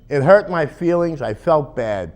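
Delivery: ordinary delivery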